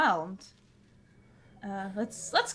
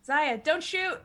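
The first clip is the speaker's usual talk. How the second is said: calling out; 5 semitones higher, 4 dB louder, much slower than usual. Acted. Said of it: "I'm gonna say".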